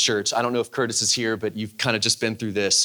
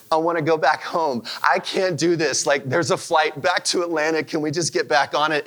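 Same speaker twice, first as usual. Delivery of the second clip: higher, pleading retold panic; panicked plea; 5 semitones higher than usual. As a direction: beg to go home, near tears but held back. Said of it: "And I'm bawling at the airport".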